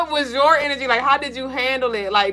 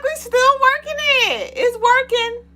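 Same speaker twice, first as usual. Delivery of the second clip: high insistent inner voice; 14 semitones higher than usual. Retold or imitated. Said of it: "I'm like".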